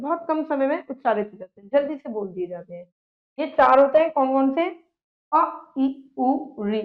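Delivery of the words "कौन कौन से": ordinary talk, nothing marked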